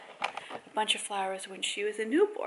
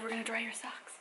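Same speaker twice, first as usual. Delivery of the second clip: whispered; 11 dB quieter than usual. Real, not acted. Soft to child.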